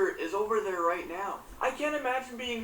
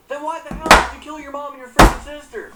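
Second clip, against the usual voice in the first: much louder, furious demand; outraged outburst; 3 semitones higher, 14 dB louder than usual. Real, not acted. Angry at the killer, a furious loud demand. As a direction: blast the question in outrage.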